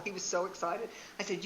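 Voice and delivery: usual speaking voice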